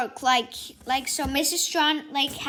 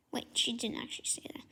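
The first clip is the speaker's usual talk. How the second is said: soft whisper to the listener; quick whispered aside to the viewers; 2 semitones lower, 11 dB quieter, faster than usual. Real, not acted. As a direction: whisper it as a correction. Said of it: "whispered aside".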